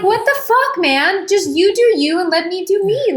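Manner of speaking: mock exasperated outburst. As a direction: throw it at him like you've had enough. Annoyed retelling of partner staring.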